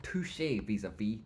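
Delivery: ordinary talk, nothing marked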